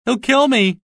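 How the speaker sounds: high, panicked whimpering plea; beg, sure you'll be killed; terrified. Acted. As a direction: Marvel hiding behind the bar, terrified. Marvel hiding in terror.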